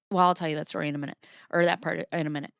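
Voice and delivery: fast throwaway aside; rushed aside. Real, not acted. Telling viewers she'll explain later, hurried aside. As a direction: rattle it off quickly.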